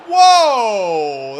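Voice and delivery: loud, startled whoa; surprised whoa. Real, not acted. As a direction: blurt it out at the near goal. Haaland misses a big chance.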